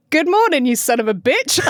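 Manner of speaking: cheerful insult with an Australian lilt; slight Australian twang. Acted. Host quoting his greetings to neighbours, slight Australian twang. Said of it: "saying it with a slight Australian twang".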